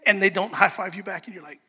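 usual speaking voice

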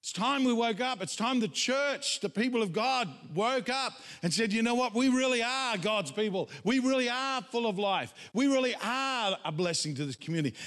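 high-pitched, driving exhortation; rousing preaching. Real, not acted. Preacher urging the church to wake up, rousing high preaching.

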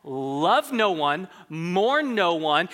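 slow, deliberate mock advice; mocking summary. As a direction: spell out the bad advice slowly. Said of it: "terrible advice like that".

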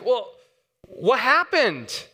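put-on a worried dad asking his little girl; gentle questioning dad. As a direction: coax the story out of her.

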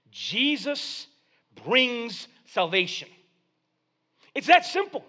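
high, loud sermon delivery; preacher driving the point home; preaching. Acted. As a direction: build the congregation up. Preaching climax.

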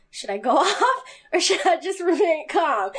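laughing inner question. Retold or imitated. Sitting there while boss yells.

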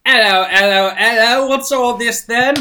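high, loud Cockney policeman; Cockney bobby accent. Pretend. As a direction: boom it like a London bobby. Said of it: "Cockney version".

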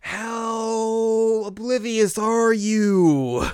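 high, loud, drawn-out howl; hammed-up exasperated cry; exasperated wail. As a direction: stretch every word in mock despair. Exasperated aside about the character.

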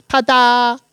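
high bright ta-da; proud show-off burst; excited. A narrator's reading. Dina shows off.

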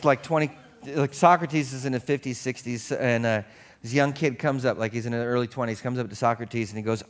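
normal talking voice

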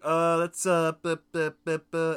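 mock fumbling for a forgotten name; stammering for a name. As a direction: stall and sputter as the name escapes you. Acting a man forgetting his date's name.

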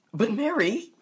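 high, loud, fast burst with a laugh; excited. Acted. John thrilled for Mary's Chicago interview, loud laughing gush. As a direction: gush with delight.

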